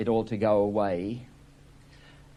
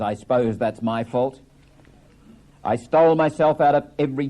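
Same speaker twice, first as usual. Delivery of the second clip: bitter blame; 3 semitones higher, 4 dB louder than usual. Acted.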